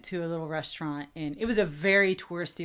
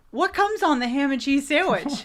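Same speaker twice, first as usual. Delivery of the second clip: higher, louder, animated retelling; raised-voice re-enacted question; retold customer question; 7 semitones higher, 6 dB louder than usual. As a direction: ask it brightly, replaying the order. Retelling her question to the server.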